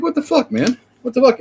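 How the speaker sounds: high, incredulous outburst; exasperated disbelief. Real, not acted. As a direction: throw up your hands and squeak it. Ranting about cleric spell lists.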